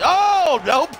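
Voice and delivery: high, loud drawn-out cry of surprise; real shocked yelp; startled outburst. Real, not acted. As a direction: burst out mid-commentary as the move goes wrong. Startled by move during match.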